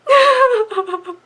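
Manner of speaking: big high burst of laughter; cracking up loudly. Real, not acted. After playing the rant, loud high laugh.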